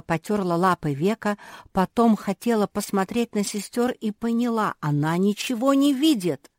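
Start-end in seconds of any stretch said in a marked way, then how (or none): none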